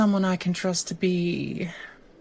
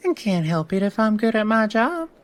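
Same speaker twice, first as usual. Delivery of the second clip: ordinary delivery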